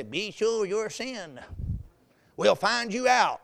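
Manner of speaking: put-on slow, drawn-out warning to a child; ominous, deliberate scripture quote; stern parent warning. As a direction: stretch it out like a parent who knows. Parent quoting verse at kids.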